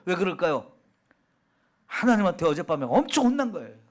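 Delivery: ordinary talk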